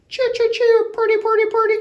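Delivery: high, loud cheery bird call; bright bird song; cardinal call. Acted. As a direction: sing it out like a cardinal. Reader voices cardinal call.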